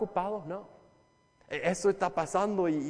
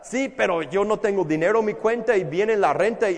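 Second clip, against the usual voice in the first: higher, louder protest, a bit rushed; worried objector; 4 semitones higher, 8 dB louder than usual. Acted. Preacher voicing a congregant's money worries, higher and louder protest. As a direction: plead the excuse like a stressed tenant.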